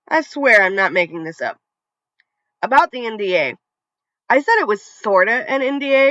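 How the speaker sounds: plain reading voice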